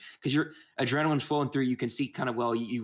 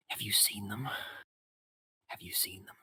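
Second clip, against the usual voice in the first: a trembling whisper, fading to breath; a scared, half-mad old man whispering his fear; whispering in terror; frightened hermit; 7 dB quieter than usual. Acted. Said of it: "He's like".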